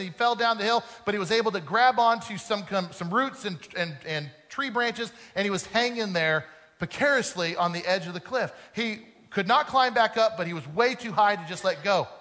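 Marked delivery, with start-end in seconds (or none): none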